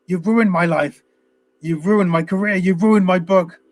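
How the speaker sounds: hammed-up angry rant, rising to a high yell at the end; mock outburst. Leo raging at Bob after the crew leave, mock fury. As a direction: imitate a doctor losing his temper. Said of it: "And he loses it with him".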